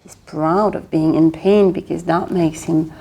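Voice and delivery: ordinary delivery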